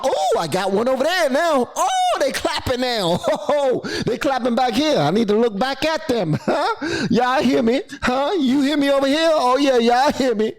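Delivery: high, excited mock preacher; crowd-working preacher. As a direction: play to a clapping congregation. Acting speaker feeding off the crowd.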